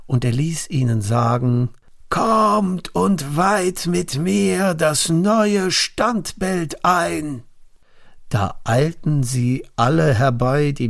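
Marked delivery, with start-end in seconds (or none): 2.1-7.4 high, slow proclamation of a king; royal summons. Acted. King's messengers call the nobles to the statue, proclaiming slow and high.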